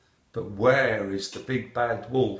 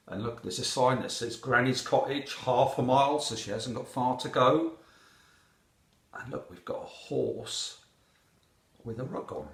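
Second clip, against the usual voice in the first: usual speaking voice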